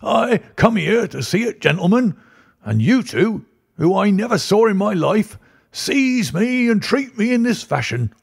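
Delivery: put-on outraged old-timer who's been grabbed; high protesting old man voice; indignant old man. A narrator's reading. Old man protests being held.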